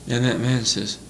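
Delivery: usual speaking voice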